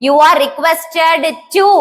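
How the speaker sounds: each word drawn out very slowly for the class; slow dictation. Shown as a demonstration. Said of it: "ப்ளீஸ்க்கு பதிலா". (மிக மெதுவாக dictation சொல்வது)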